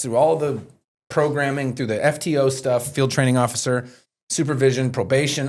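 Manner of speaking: usual speaking voice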